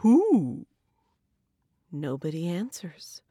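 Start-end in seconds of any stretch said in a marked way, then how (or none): 0.0-0.7 drawn-out storybook question; lightly voiced bear asking. A narrator's reading. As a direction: ask it as a curious bear. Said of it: "And the bear asks".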